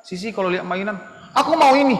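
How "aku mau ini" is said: put-on high, loud child's whine for a toy; demanding child. Child spotting a toy at the mall, loud demand. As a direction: demand it loudly like a small kid. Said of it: "anak-anak suka begitu kan".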